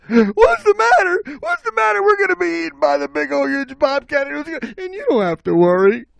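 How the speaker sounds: very high, frantic rush; hammed-up squeaky panic, racing along; panicked. Animals telling Stinky of bobcat, high frantic panic.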